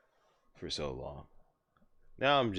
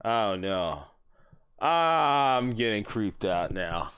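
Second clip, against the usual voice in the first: ordinary delivery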